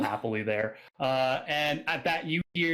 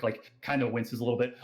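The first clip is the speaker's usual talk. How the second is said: ordinary delivery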